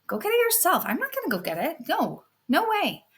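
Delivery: high, whiny refusal; sulky brat refusing her mother; high bratty no; bratty sister. Acted. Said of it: "the not so nice sister goes".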